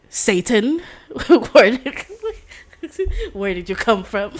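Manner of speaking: loud and breaking into laughter; can't get the line out for laughing; laughing quote. Real, not acted. Vlogger laughing while voicing the nickname line.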